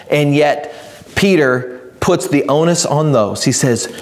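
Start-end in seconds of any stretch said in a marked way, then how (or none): none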